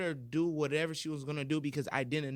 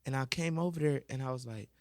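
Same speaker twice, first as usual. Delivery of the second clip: usual speaking voice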